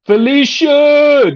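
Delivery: loud, drawn-out call of the name. Real, not acted. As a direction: call Felicia slowly across the room. Calling out a name.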